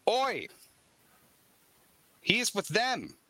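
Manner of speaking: high, sharp shout of alarm. Acted. Boy shouting to the others on the beach. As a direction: yell the warning like a wild boy. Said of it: "and shouts".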